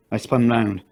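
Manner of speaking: ordinary delivery, nothing marked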